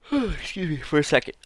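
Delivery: talking through a real yawn mid-stretch. Real, not acted. Host yawning while introducing the reviews segment, voice stretched by the yawn. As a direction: let the words stretch out on a big yawn. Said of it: "after waking up yawns when you fucking stretch".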